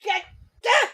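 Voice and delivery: high loud yell; shout. Real, not acted. Yelling at the clip.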